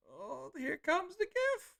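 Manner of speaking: 1 excited squeal. Real, not acted. Excited gift alert.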